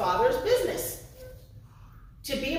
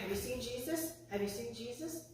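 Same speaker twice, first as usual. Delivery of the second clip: soft, imitated questioning; acted worried parent, hushed; quiet asking around; 13 dB quieter than usual. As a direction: ask discreetly, voice well down. Parents ask around for missing son.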